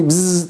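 buzzing game-show buzzer noise; imitated buzzer. The prophets' time is up, imitated buzzer. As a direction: buzz through the lips like time running out. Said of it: "the buzzer goes".

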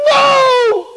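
very high, loud scream; imitated terrified shriek. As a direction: scream it in agony. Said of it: "Chaim screams".

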